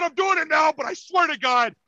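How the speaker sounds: high-pitched retold protest; indignant pleading. Accused fan swearing innocence to a cop, high indignant protest.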